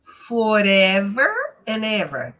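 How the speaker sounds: "Forever and ever" slowly run together; drawn-out "Forever and ever" with joined words; linked words. Shown as a demonstration. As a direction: link "and" into "ever", dropping the D. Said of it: "começou com uma vogal".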